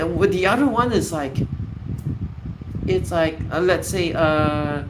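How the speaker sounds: normal talking voice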